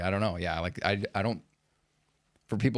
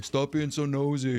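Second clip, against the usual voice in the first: higher-pitched, slow mock holler; mock yelling; 5 semitones higher, slower than usual. Imagining the neighbor Mary hanging laundry, mock yelling. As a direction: yell playfully at a nosy neighbor. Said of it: "I can just yell at her".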